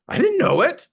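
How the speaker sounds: high-pitched disbelief; amazed realization. Retold or imitated. Speaker discovers he is an empath, amazed.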